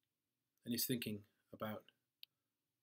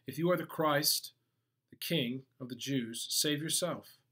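lightly acted mocking challenge; louder, raised voice with a touch of mockery; 4 semitones higher, 8 dB louder than usual. Soldiers to Jesus on the cross, mildly mocking. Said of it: "The soldiers also mocked him".

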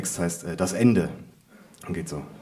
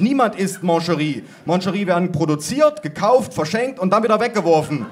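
high, loud protest; exasperated rant; 7 semitones higher, 5 dB louder than usual. A narrator's reading. Narrator ranting to family about pralines, exasperated calling. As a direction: rail against it at raised volume. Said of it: "rief ich".

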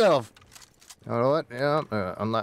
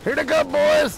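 rallying shout; 8 semitones higher, slower than usual. Acted. Sergeant rallies squad to fight.

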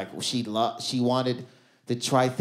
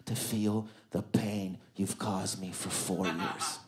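low, soft and chilling; cold vengeful girlfriend; 7 semitones lower, 9 dB quieter than usual. Retold or imitated. Girlfriend choking him in bed, low cold threat. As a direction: deliver the revenge line low and slow, dead calm. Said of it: "that's a fire-ass line".

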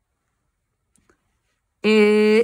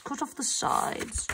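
murmur; 3 semitones higher, 9 dB quieter, slower than usual. Real, not acted. Teacher murmurs step aloud.

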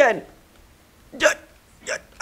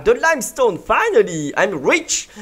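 normal talking voice

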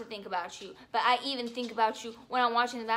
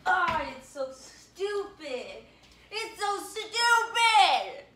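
slow, strained whine that climbs higher and louder; dramatic whine; 5 semitones higher, 6 dB louder, much slower than usual. Acted. Vlogger whining about her crush, slow and rising into a loud wail. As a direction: stretch out "so stupid" like a tantrum.

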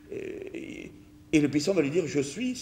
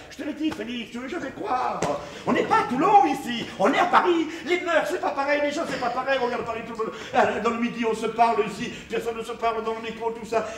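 mother voice; 10 semitones higher than usual. Retold or imitated. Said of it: "ma mère disait".

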